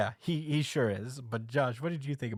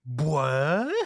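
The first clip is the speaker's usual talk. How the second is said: loud, drawn-out baffled what; acted surprise; 3 semitones higher, 7 dB louder than usual. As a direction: let out a startled, incredulous question. Denji's shocked reaction, retold.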